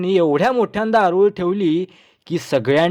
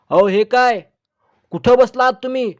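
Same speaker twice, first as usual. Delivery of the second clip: a touch of astonishment, higher and quicker; astonished; 6 semitones higher, 4 dB louder than usual. A narrator's reading. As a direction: blurt it out, taken aback. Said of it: "चकित होऊन म्हणाले".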